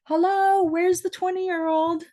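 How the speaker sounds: high, loud, drawn-out hello to the room; mock-helpless shout for help; calling out. Real, not acted. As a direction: call out for the youngster. Calling for a young person.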